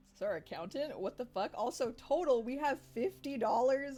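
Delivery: rising outrage at the tiny balance; shocked disbelief. Real, not acted. Player learning the shop has fifty dollars, loud shocked disbelief.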